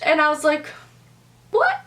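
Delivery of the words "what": high-pitched, disbelieving "what"; incredulous. Retold or imitated. Friend doubting the couple's story about the party, incredulous.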